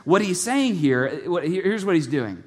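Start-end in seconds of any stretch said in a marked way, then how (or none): none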